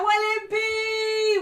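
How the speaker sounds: high, slow drawn-out praise; stretched praise. Real, not acted. Streamer drawing out thanks for a super chat. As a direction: hold every word high.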